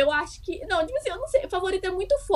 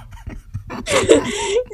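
high laugh; 9 semitones higher than usual. Real, not acted. Laughs at the Record joke.

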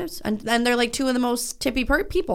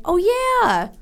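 high, delighted gush over a song; excited gushing; 9 semitones higher, slower than usual. Retold or imitated. Friend gushing about a rediscovered song, delighted. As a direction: gush like you just heard an old favorite. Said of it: "I wanna be like".

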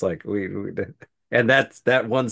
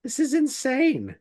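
disbelief; 14 semitones higher, slower than usual. Real, not acted. Reacting to unit names.

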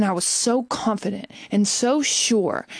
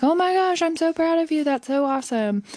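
much higher, excited congratulations; gushing boss; 8 semitones higher, faster than usual. Retold or imitated. The boss gushes over her interview, high and excited. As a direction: squeal with pride for her.

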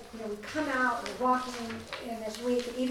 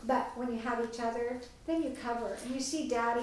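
ordinary delivery

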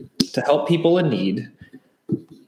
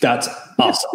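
ordinary talk

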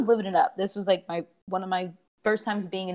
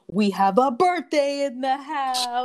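drawn-out, higher club DJ hype call; DJ announcement; 5 semitones higher, slower than usual. Retold or imitated. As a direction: announce it to the whole club, stretching the words. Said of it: "you hear the D J".

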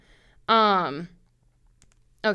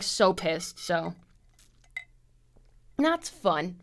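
ordinary delivery

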